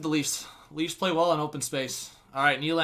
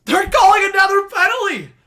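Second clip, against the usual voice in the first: much higher, louder cry of disbelief; incredulous shout; 12 dB louder than usual. Real, not acted. Reacting to another penalty.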